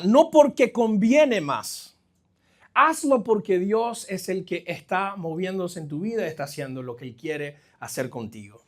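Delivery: usual speaking voice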